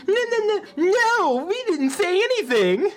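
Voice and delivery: put-on a panicked little girl; Sadako voice. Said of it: "And then Sadako".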